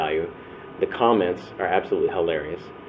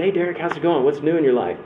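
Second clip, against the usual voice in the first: cheery greeting; 7 semitones higher than usual. Retold or imitated. Calling out car window.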